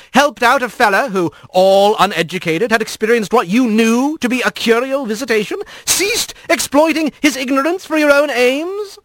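high, airy fox-lord reproach; breezy tone with a sting; light but biting. Acted. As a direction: needle them with lightly posed questions. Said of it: "His tone was light".

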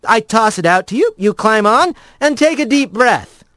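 cheerful rat giving orders. A narrator's reading. Rat character lines.

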